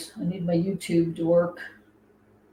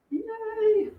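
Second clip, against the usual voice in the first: excited. Real, not acted. Moderator added successfully.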